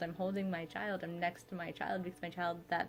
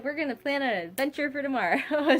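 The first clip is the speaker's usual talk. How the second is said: upbeat self-pep talk; 7 semitones higher, 8 dB louder than usual. Retold or imitated. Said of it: "I'm just like".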